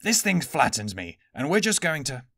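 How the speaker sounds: Aniwa's voice. A narrator's reading. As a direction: Aniwa waking up after being hit, grumbling. Aniwa protests.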